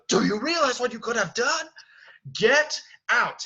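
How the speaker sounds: high, loud angry shout; acted furious outburst. Beast grabs Belle by the arm, loud furious shout. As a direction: explode at her, ending on a hard command. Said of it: "he's going to grab her by the arm".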